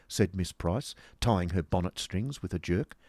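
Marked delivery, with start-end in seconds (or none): none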